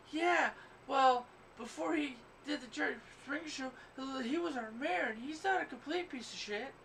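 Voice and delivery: imitating Cincinnati locals. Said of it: "everybody in Cincinnati, Ohio likes to say".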